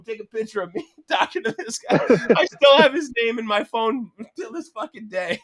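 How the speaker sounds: words broken up by real laughter, pitched high; laughing while talking. Real, not acted. Telling the host about the gun photo, laughing through it.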